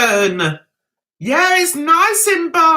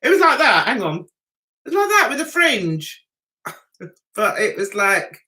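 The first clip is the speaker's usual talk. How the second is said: ordinary delivery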